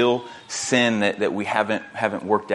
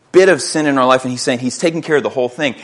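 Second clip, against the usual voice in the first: usual speaking voice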